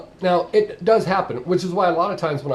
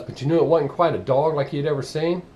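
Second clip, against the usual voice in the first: usual speaking voice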